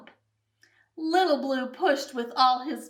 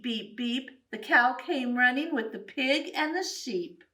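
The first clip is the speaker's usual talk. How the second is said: plain reading voice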